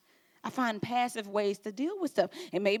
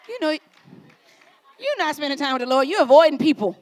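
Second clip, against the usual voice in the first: emphatic callout; 4 semitones higher, 9 dB louder than usual. Acted. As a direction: call their bluff from the pulpit. Preacher calls out the excuse.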